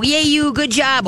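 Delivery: high, cheering dog praise; excited praise. Shown as a demonstration. Trainer praising a scared dog for stepping forward, excited. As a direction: cheer for a dog that just dared.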